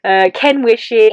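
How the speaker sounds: high, drawn-out fake laugh; covering lie. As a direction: laugh it off to hide the truth. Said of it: "my lying was so good".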